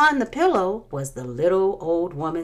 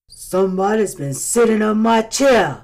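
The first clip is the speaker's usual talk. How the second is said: louder, gruff angry growl; a growling father bear; 3 semitones higher, 6 dB louder than usual. A narrator's reading. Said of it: "He was very angry".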